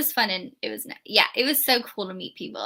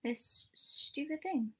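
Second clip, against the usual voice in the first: soft annoyed whisper at the list; slow whispered complaint to yourself; whispered grumble; 3 semitones higher, 9 dB quieter, slower than usual. Real, not acted. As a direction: whisper it slowly under your breath. Muttering while searching.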